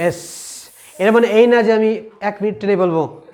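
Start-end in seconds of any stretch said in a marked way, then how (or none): 0.1-0.2 এস with air hissing through the teeth. Shown as a demonstration. Teacher shows air coming out, hissed এস. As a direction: finish এস on a sustained snake hiss. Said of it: "একটু বাতাস বেরোবে".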